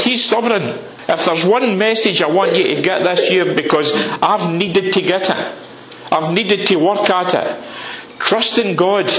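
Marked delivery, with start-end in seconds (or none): none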